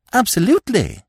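bright, high, beaming exclamation; radiant cry. A narrator's reading. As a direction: cry it out full of joy. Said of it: "cried Freddy radiantly".

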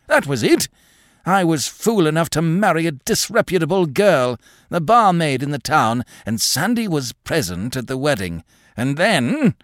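put-on high, rambling, feverish old man's voice; agitated old man. A narrator's reading. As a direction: rave at her like a haunted old man. Craven's confessing ravings.

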